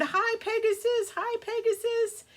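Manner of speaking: put-on high, eager little-kid greeting; excited children. Kids greeting Pegasus on his back, high excited child voice. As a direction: pipe up like a thrilled child.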